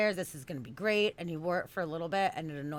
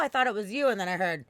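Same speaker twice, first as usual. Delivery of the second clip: usual speaking voice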